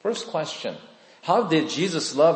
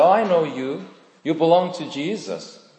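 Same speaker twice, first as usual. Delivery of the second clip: higher, louder accusing call; accusing bystander; 6 semitones higher, 4 dB louder than usual. Retold or imitated. Bystander accusing Peter of belonging to Jesus, sharp and raised. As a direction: point him out in the courtyard. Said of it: "someone said".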